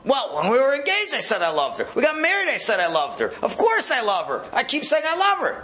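high-pitched, swooping mock protest; acted exasperated self-justification; defensive husband.

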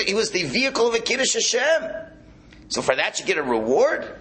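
high-pitched, impassioned protest; indignant disbelief. Acted. Teacher argues as David against any reward, indignant. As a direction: argue it in rising incredulity.

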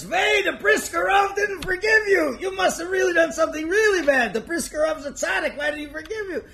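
high, loud shouted disbelief; incredulous shout. Acted. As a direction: yell it in amazement. Rebbe shouting at the host.

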